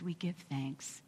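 hushed whispered thanks; whispering. Acted. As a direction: breathe the words as a whisper. Prayer ends in a whisper.

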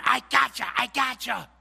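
loud, high taunting shout; gloating. Retold or imitated. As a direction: crow over the opponent like you've won. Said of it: "All they're trying to do is win".

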